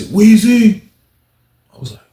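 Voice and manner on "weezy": high, loud name call. Retold or imitated. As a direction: call to someone across a hall. Imitates someone calling his name.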